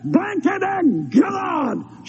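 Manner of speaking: shouting his defiance, slowing down; mock defiant yell. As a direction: hurl it at the sky, drawn out. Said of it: "and I said".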